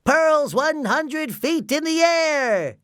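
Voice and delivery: very high, loud, drawn-out showman's call; slow, high-pitched grand announcement; announcer's voice. A narrator's reading. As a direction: proclaim it like a cartoon ringside announcer. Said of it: "switched back to his announcer's voice".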